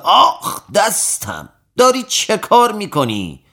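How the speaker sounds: a sharp high yelp of pain, then a startled question; cry of pain. A narrator's reading. Strongman yelping at the barber's first needle prick, pained cry. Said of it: "پهلوان فریاد زد".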